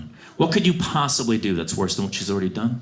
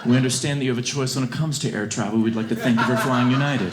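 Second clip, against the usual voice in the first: mock flight attendant; 3 semitones higher than usual. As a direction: gently wake them with fake courtesy. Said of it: "Just be like".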